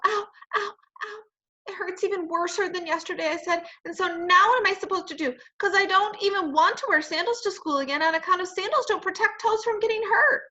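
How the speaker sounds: high, whiny tearful complaint; tearful whine. Acted. Junie complains to her parents about her toe, high tearful whine. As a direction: whimper and complain like a hurt little girl. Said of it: "tears came in my eyes".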